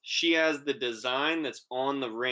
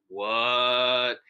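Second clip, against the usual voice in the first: stunned; 4 dB louder than usual. Retold or imitated. Baffled at koi fish hallucination.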